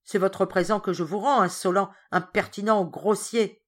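loud, scornful scolding; angry insults. Acted. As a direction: spit out the insults. Georges insults Ram.

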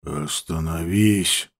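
low, quiet, slow hush; hushing snap. Acted. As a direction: shush her sharply. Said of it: "шикнул на неё Ауранг".

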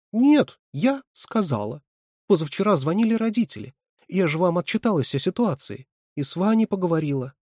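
high, insistent protest; anxious self-defence. A narrator's reading. Катя justifies herself to her boss, anxiously insistent.

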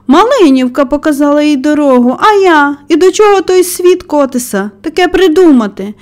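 high-pitched, indignant storytelling outburst; angry yell. A narrator's reading. Old man railing about the robin, angry yell. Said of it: "заволав він".

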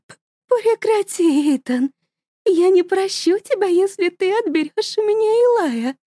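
mother begging husband to stop; trembling voice. Acted. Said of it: "дрожащим голосом". (высоко, дрожащим голосом)

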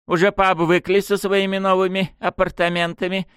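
high, sneering mock-politeness. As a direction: taunt her with sweet contempt. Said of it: "насмешливо осведомилась она".

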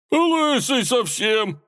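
very high, slow, gleeful squeal; cracking up. A narrator's reading. Robber teasing his bald old friend, hilarity. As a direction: squeal it, tickled to bits. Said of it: "покатился со смеху соловей-разбойник".

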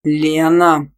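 loud, drawn growl of reproach. A narrator's reading. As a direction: growl the name as a warning. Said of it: "прорычал Тима".

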